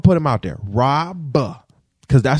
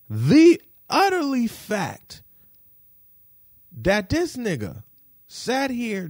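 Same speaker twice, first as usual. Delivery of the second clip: high, slow, drawn-out disbelief; slow outrage; 6 semitones higher, slower than usual. Real, not acted. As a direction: stretch it out, indignant. Outrage at the delivery.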